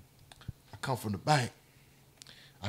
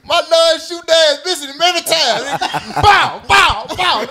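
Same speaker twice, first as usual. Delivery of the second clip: laughing shout; 9 semitones higher than usual. Real, not acted.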